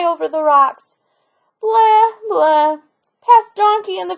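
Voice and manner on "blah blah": high, loud goat blah; a playful goat bleat. Acted.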